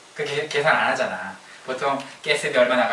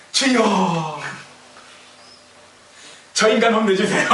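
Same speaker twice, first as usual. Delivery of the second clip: high, loud cry up to heaven; comically shouted plea to God; hammed-up wailing prayer; mock fervent prayer; 7 semitones higher, 4 dB louder, slower than usual. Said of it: "이렇게 기도하겠죠".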